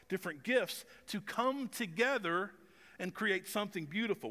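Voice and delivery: ordinary talk, nothing marked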